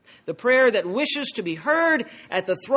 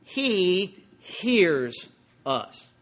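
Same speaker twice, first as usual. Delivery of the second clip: He hears us, drawn out slowly, each word weighted; slow emphasis on He hears us; much slower than usual. Shown as a demonstration. Preacher lingering on the promise, slow and emphatic. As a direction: savour the words He hears us slowly. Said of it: "And I love those words".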